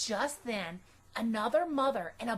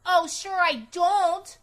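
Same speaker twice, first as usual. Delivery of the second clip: put-on louder, higher, slow defiant girl; sassy comeback; 7 semitones higher, 9 dB louder, slower than usual. A narrator's reading. Girl contradicting her mother at bedtime, sassy and slow. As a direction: throw it right back. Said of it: "I said back".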